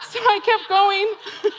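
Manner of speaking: high, slow wail with laughter in it; hammed-up weepy narration, drawn out; mock emotional. Runner pushing on over the bridge, mock emotional wail. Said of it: "It's really emotional".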